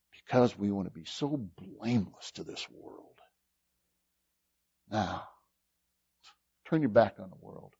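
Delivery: soft, low, intimate sermon voice; quiet pleading preacher; hushed altar-call preaching. Acted. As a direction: draw them in quietly, almost confiding.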